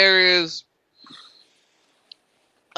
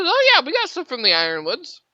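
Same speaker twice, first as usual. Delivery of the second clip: chirpy waitress; 8 semitones higher, faster than usual. Acted. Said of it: "She goes".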